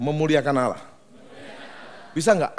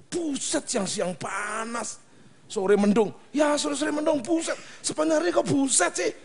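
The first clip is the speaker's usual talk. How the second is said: higher, whiny mock complaint; mock complaining; 11 semitones higher than usual. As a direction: whine about the weather, hammed up. Mock complaining about weather. (keluhan pura-pura yang merengek tinggi)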